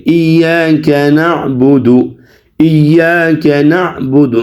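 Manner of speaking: slow, measured recitation with drawn-out vowels; Quran recitation. Shown as a demonstration. Teacher models the verse for students, slow recitation. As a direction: recite slowly and evenly, stretching long vowels.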